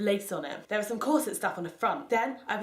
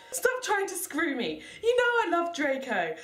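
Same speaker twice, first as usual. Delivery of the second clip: shout; 8 semitones higher than usual. Acted. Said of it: "I shouted".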